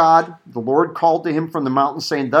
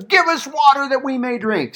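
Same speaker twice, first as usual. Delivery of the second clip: higher-pitched imitation of a complaining crowd; complaining demand; 9 semitones higher than usual. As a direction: read it as the people demanding of their leader. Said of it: "So they're complaining again".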